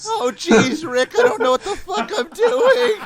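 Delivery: high, panicky stammering Morty voice. Retold or imitated. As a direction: squeak it out in a nervous panic, laughing. Rick and Morty bit.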